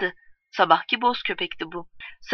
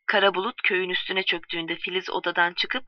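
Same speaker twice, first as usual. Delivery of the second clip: ordinary delivery, nothing marked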